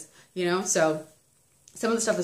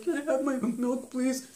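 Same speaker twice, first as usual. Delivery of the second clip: put-on small, high, soft toddler voice; meek child's request, imitated; imitated little child; 5 semitones higher, 6 dB quieter than usual. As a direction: ask softly and high, like a little kid. Imitates calmed son asking for milk.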